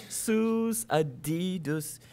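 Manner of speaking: put-on slow "sooz Adidas" in a learner's accent; new kid's foreign accent. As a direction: drag out the few accented words. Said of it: "all he could say was".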